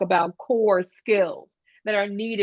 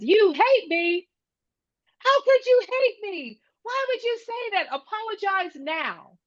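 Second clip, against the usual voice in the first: high-pitched mock outrage of a hurt kid; indignant child; 12 semitones higher than usual. Jeffrey confronts B J for saying hate, high indignant kid voice. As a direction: act out a wounded child demanding an apology, pitched way up. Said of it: "B J screams and he says".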